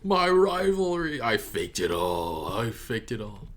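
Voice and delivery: mock a betrayed rival, drawn out and fading; hammed-up wail that falls away; mock heartbroken Kaiba. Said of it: "He's just like".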